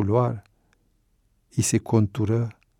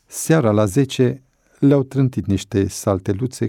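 ordinary delivery, nothing marked

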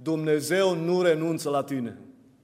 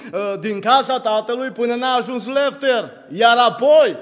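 raised, driving sermon voice; impassioned preaching; 5 semitones higher, 6 dB louder than usual. Real, not acted. Preacher recounts the lost son's regrets to the congregation, impassioned preaching. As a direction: preach it with building force.

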